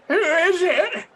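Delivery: put-on high, loud old granny voice. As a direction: call out like a granny. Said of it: "do my best Granny impression".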